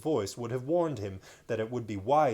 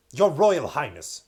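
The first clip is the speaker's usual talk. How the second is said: stern king; 3 semitones higher, 10 dB louder than usual. Acted. King addressing Caspian sternly.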